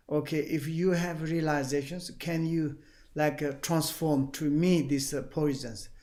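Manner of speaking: ordinary talk, nothing marked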